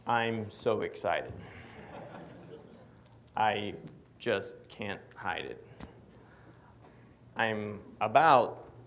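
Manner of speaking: slow, low, unexcited theme-song recital; regal deadpan dog. Acted. Regal old chicken dog giving his theme song, deadpan. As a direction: recite it slowly with long pauses, dignified and subdued. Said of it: "His theme song's a little different".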